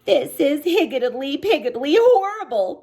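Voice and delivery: high, sorrowful bunny voice; a mild sad lament; sad rabbit voice. Acted. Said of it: "Peter said sadly to himself".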